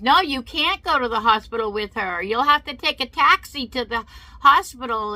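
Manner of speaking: retold yelling. Lady refusing to let the best friend ride along, imitated yelling. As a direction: shut it down forcefully, mimicking the yeller. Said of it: "to hear all this yelling".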